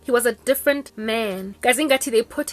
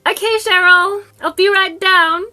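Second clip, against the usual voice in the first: forced polite reply; 9 semitones higher, 10 dB louder than usual. Retold or imitated. Her own forced-polite phone reply.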